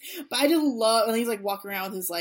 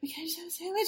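timid ask; 10 semitones higher, 5 dB quieter, much faster than usual. Retold or imitated. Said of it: "I was just like".

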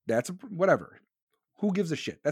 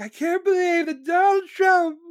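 very high mock-hysterical wail; high caricature of a scandalized liberal; whiny outraged reader; 12 semitones higher, 4 dB louder than usual. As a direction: squeal in exaggerated disbelief. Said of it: "These ignorant Dem motherfuckers are probably reading this, be like".

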